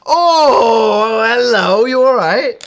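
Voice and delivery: high, loud delighted hello; excited greeting. Real, not acted. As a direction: greet him brightly and loud. Greeting caller on Skype.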